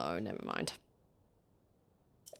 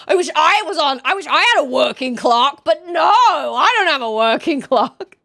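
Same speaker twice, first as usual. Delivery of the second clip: loud, raised-pitch mock complaint; mock rant; 5 semitones higher, 5 dB louder than usual. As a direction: whinge in play outrage, breaking into a laugh. Mock rant about broken clock.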